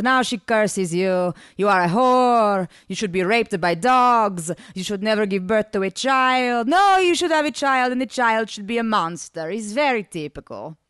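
put-on loud, drawn-out wail in accented English; hammed-up woeful foreign lover; groaning lament. A narrator's reading. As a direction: groan out the curses slowly and theatrically. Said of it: "Ivan groaned".

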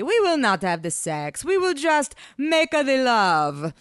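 sweetly hammed-up accented seduction; sweet-talking. A narrator's reading. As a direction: coax her with a drawn-out flourish. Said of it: "Ivan murmured sweetly".

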